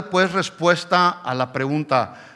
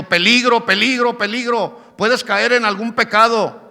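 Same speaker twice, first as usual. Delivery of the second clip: higher, louder alarm voice calling "peligro"; a higher, louder warning voice for the inner sensor; 6 semitones higher, 5 dB louder than usual. Acted. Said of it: "que nos está advirtiendo".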